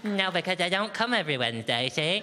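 mock-patient nursery voice for the housemates; sing-song voice for housemates. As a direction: talk down to them like a nursery teacher. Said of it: "Why are you talking like that".

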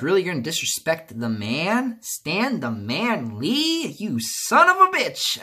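slow, rising outrage that snaps at the end; angry retort. Retold or imitated. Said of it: "'cause he's like".

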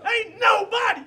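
high excited shout. Real, not acted. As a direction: shout it out in triumph. Celebrating the win, shouting.

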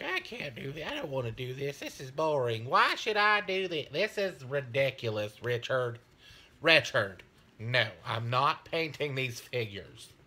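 lightly voiced whiny wife; mock-complaining wife, only a hint of a voice; half-hearted whiny wife impression; impersonating wife. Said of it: "That's exactly how she sounds".

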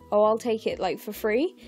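usual speaking voice

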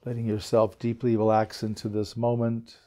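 unhurried meditation guide, long pauses between phrases; guided meditation. Acted. Teacher settling a group into belly breaths, calm guided meditation. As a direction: ease them gently into relaxing, leaving space after each instruction.

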